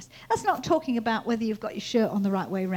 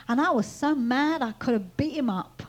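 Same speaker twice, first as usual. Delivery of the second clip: ordinary talk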